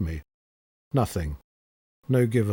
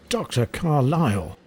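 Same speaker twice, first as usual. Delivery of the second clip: indignant raised cry, a little higher; theatrical outrage; 4 semitones higher than usual. A narrator's reading. Flustered nurse scandalised by the doctor's kiss, calling out in theatrical outrage. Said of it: "Her voice rang out with such theatrical outrage".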